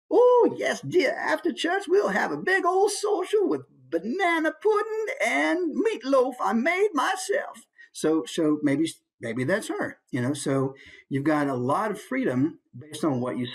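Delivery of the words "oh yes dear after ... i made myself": put-on very high old-lady falsetto; older churchgoing lady. Shown as a demonstration. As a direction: gush sweetly about the church social. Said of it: "older female".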